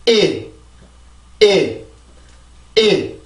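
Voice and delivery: "일" as a stressed beat with falling pitch; stressed syllable demo. Shown as a demonstration. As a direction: punch out each "일" separately. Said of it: "강세를 만들어줍니다".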